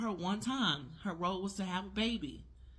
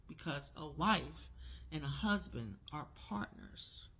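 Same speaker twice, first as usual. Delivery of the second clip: ordinary talk, nothing marked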